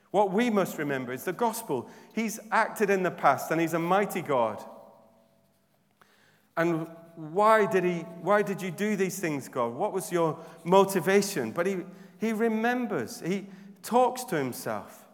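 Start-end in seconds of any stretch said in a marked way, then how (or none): none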